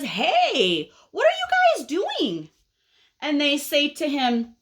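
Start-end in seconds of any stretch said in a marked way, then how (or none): 0.0-2.5 high-pitched nosy caterpillar; impatient caterpillar. Acted. Caterpillar calls out to the others, impatient. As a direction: pipe up high with a long rising question. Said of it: "here's the impatient caterpillar, and he says".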